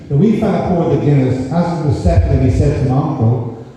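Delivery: ordinary talk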